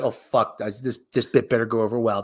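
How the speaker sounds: low-pitched imagined thought of a worried comic; imitating a worried comedian, pitched low; nervous comedian's inner voice. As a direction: voice his private worry, dropping well down. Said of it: "he actually looks like".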